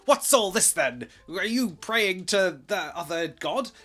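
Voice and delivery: loud, high mock policeman bellow; hammed-up bobby barking at the drinkers; mock stern copper. Mock constable booming at the praying Catholics. Voicing King's men raiding.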